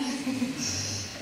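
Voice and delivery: brief unvoiced chuckle; real, light laugh; short laugh. Real, not acted. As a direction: chuckle at your own joke. Laughs at own joke to audience.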